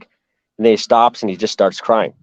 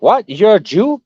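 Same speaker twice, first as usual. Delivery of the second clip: incredulous question, pitch jumping up; retold surprise; 8 semitones higher than usual. Woman reacting to the soldier's revelation, surprised. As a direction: act out her taken-aback double question.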